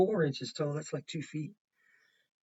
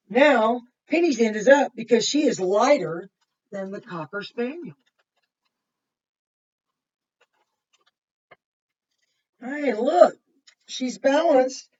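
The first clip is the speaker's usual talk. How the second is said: bright, louder storybook read-aloud; reading to children; 4 semitones higher, 10 dB louder than usual. Acted. Reading a picture book to kids, bright and lively. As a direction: read it out lively for the little ones.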